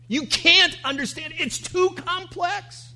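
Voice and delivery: loud, high-pitched insistence; emphatic exclamation. Retold or imitated. God telling Job he'll never get it, emphatic high exclamation. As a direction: burst out high and loud, driving the point home.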